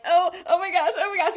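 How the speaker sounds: high, loud flustered gushing; flustered squeal. Retold or imitated. Women asked how to be supported, a high flustered squeal. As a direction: act panicked and caught off guard by the offer.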